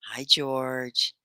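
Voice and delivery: usual speaking voice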